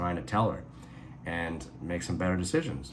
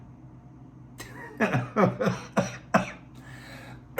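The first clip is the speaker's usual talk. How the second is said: high, breathless laugh bursting out; real burst of laughter; 14 semitones higher than usual. Real, not acted. Laughs at his own mock line.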